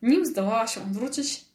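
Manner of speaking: usual speaking voice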